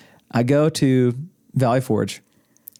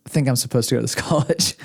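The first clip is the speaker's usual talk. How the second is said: ordinary talk, nothing marked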